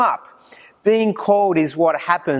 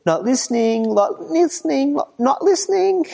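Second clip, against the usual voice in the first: put-on childish singsong climbing higher with each repeat; stubborn little kid; 8 semitones higher than usual. Preacher imitating a kid ignoring God, rising singsong. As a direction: taunt like a kid with fingers in his ears, rising each time. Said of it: "like the little kid who puts his fingers in his ears".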